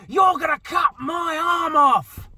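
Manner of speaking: high, loud shrieking threat; neighbour's scream. Retold or imitated. Woman shrieking at the gardener over the hedge, shrill scream.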